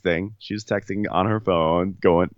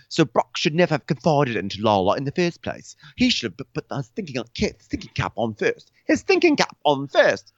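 mock James; 8 semitones higher than usual. Imitating James.

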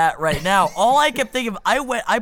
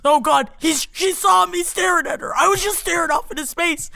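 panicked; 7 semitones higher than usual. Retold or imitated. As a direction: freak out about being noticed. Panicking stoned friend voiced.

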